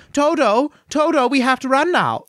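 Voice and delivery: put-on high, old-movie Dorothy voice; [name] impression. Mocking how Dorothy sounds in the film, high and bright. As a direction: lift it way up like a thirties starlet. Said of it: "who talks like this".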